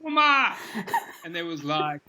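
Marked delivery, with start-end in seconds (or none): 0.0-0.6 building to a high, loud, frantic Spanish goal call; Spanish-language play-by-play climbing into a goal yell; Argentine soccer commentary. Shown as a demonstration. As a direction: commentator rising into a screamed gol. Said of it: "you want me to see if I can remember a few?".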